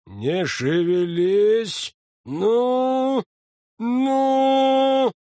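rising loud effort; heaving on the tooth; straining shout. A narrator's reading. Фельдшер тянет из сил. (напряжённый крик)

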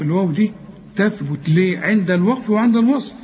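ordinary talk

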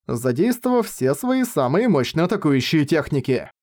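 ordinary delivery

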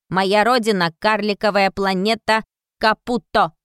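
measured robotic statement; robot answer. A narrator's reading. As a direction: state your origin like a machine. Robot character answers about origin.

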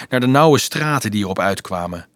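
ordinary delivery, nothing marked

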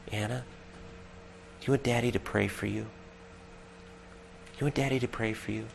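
hushed, loving daddy at bedtime; gentle daddy voice. Retold or imitated. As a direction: kneel by her bed and offer softly. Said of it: "use my daddy voice".

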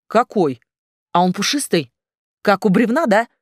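plain reading voice

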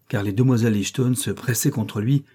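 plain reading voice

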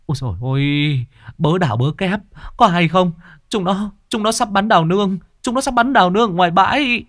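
frantic shouting. Acted. Villager shouting the news to the theatre troupe, panicked. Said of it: "Tiếng kêu vang vọng".

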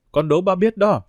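put-on girl teasing her father with a loud call; calling out loudly. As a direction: shout the riddle out playfully to dad. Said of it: "vọt tiếng nói lớn".

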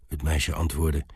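usual speaking voice

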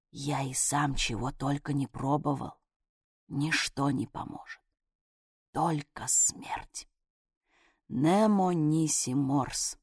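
low, hushed near-whisper. Acted. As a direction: lean in and drop to a whisper. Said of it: "понизил голос и наклонился чуть не к уху".

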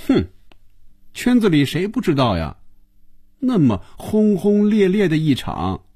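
scoff, then stretch the jibe high. A narrator's reading. Phoebe mocking Hongjun's famous affair, biting sarcasm. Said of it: "更加讽刺地对红军说".

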